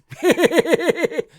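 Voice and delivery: high, loud madman cackle; maniacal cackle. Pretend. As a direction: cackle like a lunatic. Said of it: "No, he doesn't laugh like that".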